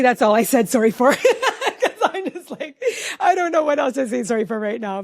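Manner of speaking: talking through real laughter; amused confession broken up by laughs; laughing. Real, not acted. Mom admitting how little she apologized for, laughing.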